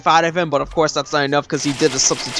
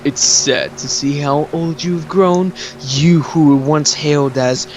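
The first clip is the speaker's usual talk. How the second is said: slow, theatrical villain taunt; Orochimaru; slower than usual. Retold or imitated. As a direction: draw out each phrase with mock sorrow. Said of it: "And Orochimaru is like".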